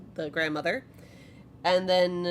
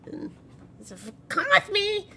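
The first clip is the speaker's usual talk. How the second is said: high, loud, reluctant little voice; grudging goblin child; 10 semitones higher, 8 dB louder than usual. Acted. Child gives in and leads guests upstairs, loud and grudging. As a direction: give in loudly with a childish groan. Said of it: "And it's like".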